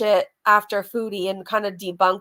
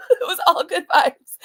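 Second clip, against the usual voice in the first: laughing; 5 semitones higher, 4 dB louder than usual. Real, not acted. Laughing repeat.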